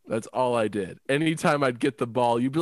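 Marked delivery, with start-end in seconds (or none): none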